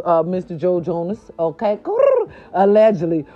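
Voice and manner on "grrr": high, loud mock growl; a sassy little growl; playful growl. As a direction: growl like a teasing cat. Growl teasing the gossip.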